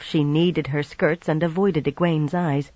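ordinary delivery, nothing marked

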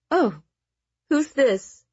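high, playful question; giggly. A narrator's reading. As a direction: ask with girlish delight. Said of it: "Araminta giggled".

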